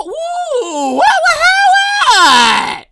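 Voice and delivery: very high, stretched squawk of "what"; mock disbelief. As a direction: squeal it as if stunned by the score. Mock shock at a low score.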